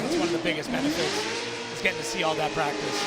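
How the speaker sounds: loud play-by-play voice; live commentary. Acted.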